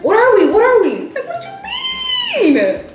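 high, loud, exasperated mock yell; retold phone yelling. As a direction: imitate him going off, last words stretched into a high wail. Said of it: "he started yelling on the phone".

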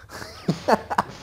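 short, high breathy cackle; high laugh. Real, not acted. Player laughing after a jibe, high cackle. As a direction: crack up at your own jibe.

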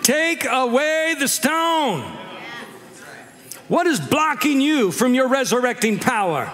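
high, driving, stretched-out sermon cry; full-force preaching. Real, not acted. Preacher urging the congregation to remove the stone, full-force preaching. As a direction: preach it out high, building relentlessly to the climax.

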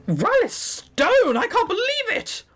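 put-on high, starstruck young gem crafter; high gushing fangirl voice. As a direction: gush like a starstruck fan. Gushing fan character voice.

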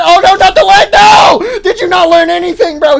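real dismayed yelling, pitched high; anguished shout. Real, not acted. Watching Catnap land badly, a loud anguished shout. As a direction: cry out as the fall goes wrong. Catnap breaks legs on landing.

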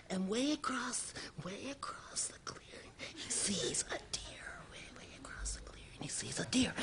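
hushed, suspenseful storyteller's whisper; stage whisper. Acted. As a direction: whisper it so the deer won't hear. Hushed telling as deer is spotted.

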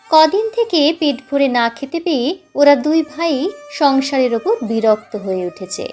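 usual speaking voice

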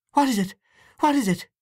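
high, loud and alarmed; mother rushing in, panicked and high; alarmed cry. Acted. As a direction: burst in frightened for the child. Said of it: "she cried".

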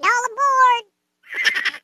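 train conductor call. Pretend. Calling out the Stacks train.

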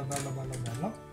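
usual speaking voice

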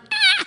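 short, very high yelp; loud squeal of alarm at yourself; panicked yelp. Retold or imitated. Kid whose hand goes up by itself, panicked yelp.

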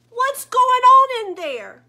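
annoyed father calling. Acted. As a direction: yell it like a dad down the hall. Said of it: "I heard my father's voice".

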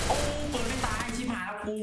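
panicked high exclaim. Real, not acted. Gamer panicking as an enemy survives, yelping high. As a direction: cry out high as the fight turns bad.

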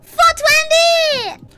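high, slow drawn-out cheer; excited cheer. Acted. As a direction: stretch it out, cheering. Cheering weed joke.

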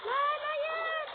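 high, loud no; frustrated cry. Real, not acted. Player crying out as the round goes wrong, frustrated. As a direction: wail at the loss. Losing in game.

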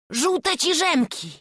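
high, loud, slow blurted cry; boy's shout. A narrator's reading. Chłopiec wykrzykuje swoje marzenie królewiczowi, wysoko i głośno. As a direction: blurt it out like an excited child. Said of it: "wrzasnął Wawrzuś".

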